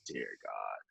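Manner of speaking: low, hushed aside; quiet, low-pitched quoted reaction; retold exasperation. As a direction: mutter it under your breath. Muttered reaction to the quarrel.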